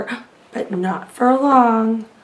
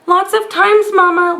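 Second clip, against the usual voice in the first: put-on higher, sheepish childlike whine; whimpering giant; 6 semitones higher than usual. A narrator's reading. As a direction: whine like a scolded kid. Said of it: "whimpered the giant".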